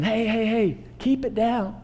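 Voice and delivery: crowd shushing. Retold or imitated. Preacher voicing the crowd hushing the blind man, mock scolding. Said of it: "So picture them saying".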